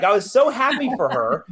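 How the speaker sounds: ordinary delivery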